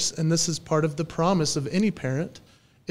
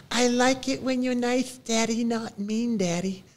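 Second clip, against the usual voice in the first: Romney; 8 semitones higher than usual. Retold or imitated. Three-year-old telling Daddy what he likes, little-kid voice. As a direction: imitate an earnest three-year-old, pitched up. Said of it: "And he goes".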